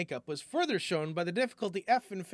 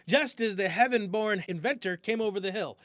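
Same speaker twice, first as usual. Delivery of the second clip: plain reading voice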